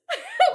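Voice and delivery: a loud, high burst of laughter. Real, not acted. Telling a podcast host about the dishes, laughing out loud.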